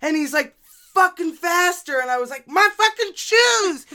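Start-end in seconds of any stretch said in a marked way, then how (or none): 0.9-2.0 barked order. Retold or imitated. Officer barking order. 2.5-3.8 high, loud cry of protest. Retold or imitated. Protesting officer, worried about shoes.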